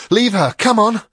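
loud urgent shout to the cat. A narrator's reading. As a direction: yell it high and loud. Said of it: "she shouted to the cat".

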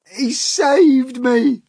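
high, loud, drawn-out amazed cry; hammed-up astonished centipede; gasped. A narrator's reading. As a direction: cry it out slowly, overcome at being rescued. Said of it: "gasped the centipede".

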